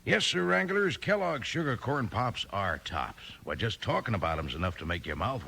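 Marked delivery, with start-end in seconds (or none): none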